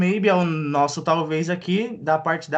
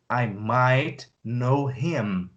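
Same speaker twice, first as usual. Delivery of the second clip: lower, slow, leaning on "might"; low slow repeat; slow, low stress on might; 4 semitones lower, much slower than usual. Shown as a demonstration. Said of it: "repeat take".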